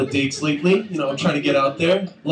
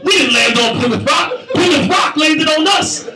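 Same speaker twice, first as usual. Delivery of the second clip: Malcolm X; 9 semitones higher than usual. Retold or imitated. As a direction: preach it like Malcolm X. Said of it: "I started doing Malcolm X".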